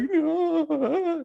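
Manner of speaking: put-on very high, stretched-out kid's whine; mock crying wail. Boy told to eat the banana, high mock crying wail. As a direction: imitate a child wailing in refusal. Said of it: "he's crying".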